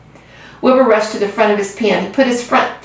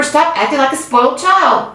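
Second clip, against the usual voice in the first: scolding; 6 semitones higher than usual. A narrator's reading. Wilbur scolding the rat.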